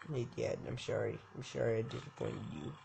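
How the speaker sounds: hushed mock remorse; mock quiet apology. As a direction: mumble a sorry. Apologizing to dad.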